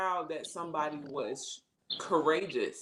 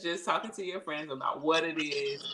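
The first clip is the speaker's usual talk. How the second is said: ordinary talk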